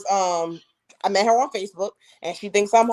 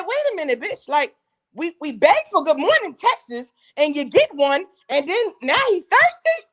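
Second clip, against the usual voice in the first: high-pitched, disbelieving comeback; mock incredulity; 7 semitones higher than usual. Woman challenging girlfriend over good morning texts, high mock incredulity. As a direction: squeal the objection in comic disbelief.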